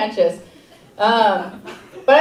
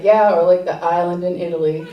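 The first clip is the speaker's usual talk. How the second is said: weary mom; 6 semitones lower, faster than usual. Retold or imitated. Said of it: "She's like".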